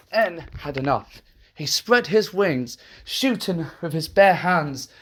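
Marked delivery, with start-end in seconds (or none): none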